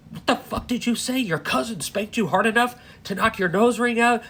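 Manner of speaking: father hears his daughter was hurt, outraged and high-pitched; outraged disbelief. Retold or imitated. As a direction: retell the shock like you can't believe it.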